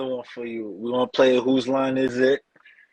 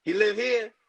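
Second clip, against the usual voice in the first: put-on much higher, Spanish-accented shrug; owner accent; 9 semitones higher than usual. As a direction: dismiss the complaint in the owner's accent. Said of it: "owner answers".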